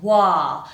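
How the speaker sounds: "wa" with a clear open ah; clear strong syllable. Shown as a demonstration. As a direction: draw out "wa" with a full vowel. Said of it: "we hear a clear vowel sound".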